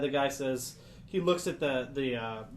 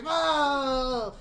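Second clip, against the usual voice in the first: high, loud frightened yelp; scared cry; 11 semitones higher, 6 dB louder than usual. Acted. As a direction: yelp in terror like a cornered creature. Scared Darrow cries out.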